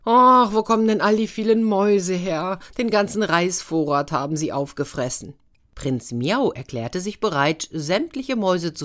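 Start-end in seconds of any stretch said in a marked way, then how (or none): 0.0-5.3 put-on louder, higher sighing lament of an old king. A narrator's reading. King lamenting the mice that ate his rice, sighing. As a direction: moan it out like a weary king. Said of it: "saß der König und seufzte".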